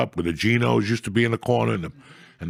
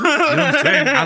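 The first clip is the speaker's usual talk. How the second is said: laughing; 14 semitones higher, 7 dB louder than usual. Real, not acted. Punchline about gas leak.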